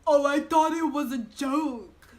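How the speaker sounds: put-on a light parody of a clueless boy; mocking George. Mocking the boy's excuse in a slow dopey voice. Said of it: "He says".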